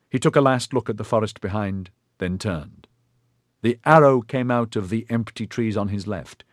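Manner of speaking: plain reading voice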